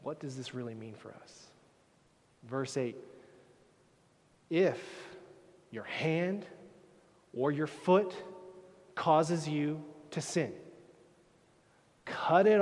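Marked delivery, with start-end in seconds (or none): 4.5-5.2 loud, slow, emphatic reading of the warning; forceful scripture reading. Acted. Preacher reading Jesus' warning to the congregation, forceful declamation. 5.7-8.1 loud scripture reading. Acted. Preacher reading the verse to the crowd, loud proclamation. Read aloud, then echoed softly.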